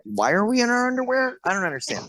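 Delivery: high, incredulous question; baffled outcry. Retold or imitated. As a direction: ask it like you can't believe the costume. Actors baffled on set.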